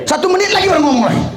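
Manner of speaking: laughing. Real, not acted. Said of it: "Capek kita mau dengarnya".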